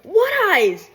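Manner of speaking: high, loud disbelief; mock incredulous cry. Dreamer answering partner's remark about eyes, high mock disbelief. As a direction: yelp it in exaggerated disbelief. Acted startled protest to partner.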